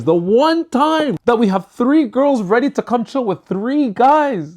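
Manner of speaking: high and slow, leaning on "three"; stressing the irony with mock disbelief; emphasized. Real, not acted. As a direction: stretch out "the one time" and punch "three". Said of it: "let me emphasize".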